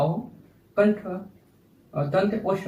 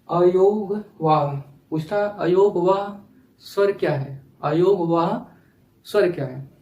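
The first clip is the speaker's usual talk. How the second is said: slow emphatic term; 4 dB louder, slower than usual. Shown as a demonstration. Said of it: "teacher stressing exam term".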